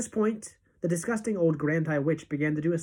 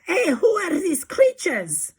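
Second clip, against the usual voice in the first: much higher shrieking teacher; mock horrified yell; 12 semitones higher, 4 dB louder than usual. Witch voices demented teachers, mock horrified yell. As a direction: cry out in horror. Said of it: "teachers is yelling".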